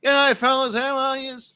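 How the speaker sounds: very high, loud cartoon kangaroo greeting; hammed-up squeaky animal character hailing the blokes; dopey kangaroo. Kangaroo hails two fishermen on Ayers Rock, dopey cartoon voice. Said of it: "dopey kangaroo comes topping over".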